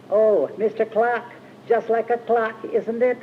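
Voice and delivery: gently mocking falsetto impression; imitating a falsetto. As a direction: mimic a high fluting falsetto. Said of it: "with this falsetto".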